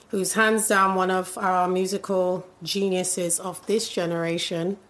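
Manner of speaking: ordinary talk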